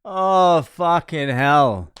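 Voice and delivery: exasperated groan. Real, not acted. Dreading tonight's gig, a loud slow exasperated groan. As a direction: let the frustration spill out.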